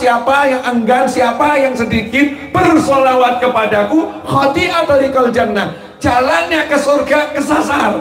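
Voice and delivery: high, fervent preaching voice, slow and drawn out. Acted. Preacher to congregation explaining the hadith, fervent high preaching. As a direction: preach it with rising fervour to the crowd.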